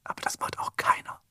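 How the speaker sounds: whispered reassurance that nobody does it; conspiratorial whisper. Real, not acted. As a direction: hush it back, barely voiced. Agreeing in the secret whisper.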